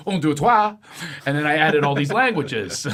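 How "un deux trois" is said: French count. Shown as a demonstration. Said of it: "as they say in France".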